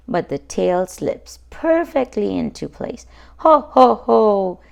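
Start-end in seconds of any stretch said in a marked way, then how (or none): 3.4-4.6 loud hearty ho ho ho; a jolly chuckle of success; chuckling. Acted. Daddy Pig pleased the tail fits, hearty loud chuckle. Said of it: "chuckles Daddy Pig".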